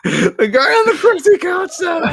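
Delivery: very high, laughing wail; mock desperate cry. Pleading while taken to jail, mock desperate wail. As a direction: protest in squealing play panic. Acts being hauled to jail.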